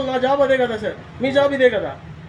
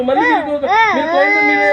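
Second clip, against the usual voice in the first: sudden louder angry burst, words tumbling; angry outburst; 2 semitones higher, 5 dB louder than usual. Real, not acted. Employee shouting back at the official, angry. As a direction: cut in hard, raised and heated. Heated argument, voice spikes.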